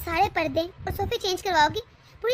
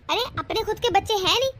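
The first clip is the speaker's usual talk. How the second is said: usual speaking voice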